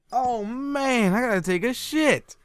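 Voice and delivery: high, drawn-out retold moan; mock urgent whine.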